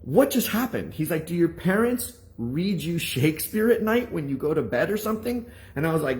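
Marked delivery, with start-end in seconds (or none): 0.0-0.9 very high, incredulous outburst; shocked. Retold or imitated. Teacher reacting to the boy's perfect reading, shocked. 1.3-5.5 high, incredulous questioning; shocked. Retold or imitated. Teacher quizzing the boy about his parents, shocked disbelief.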